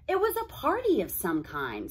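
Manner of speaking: ordinary delivery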